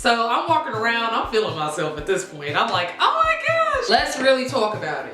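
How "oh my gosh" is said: high excited oh my gosh; thrilled oh my gosh. Retold or imitated. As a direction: gush it, giddy. Feeling herself in the store.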